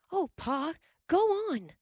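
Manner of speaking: high pleading cry; eager cry. A narrator's reading. Laura begging Pa to finish the story, high eager cry. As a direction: beg him high and eager to continue. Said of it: "Laura cried".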